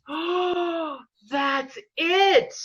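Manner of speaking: a sudden tearful breakthrough; overwhelmed revelation. Retold or imitated. On the mountain, hearing the answer, a tearful high shout.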